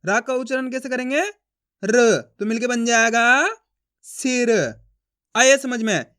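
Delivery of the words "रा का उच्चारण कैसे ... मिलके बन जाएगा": ordinary talk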